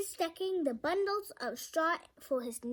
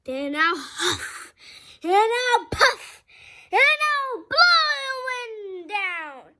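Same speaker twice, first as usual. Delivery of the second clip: laughing wolf; 7 semitones higher, 12 dB louder, slower than usual. A narrator's reading. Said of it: "laughed the wolf".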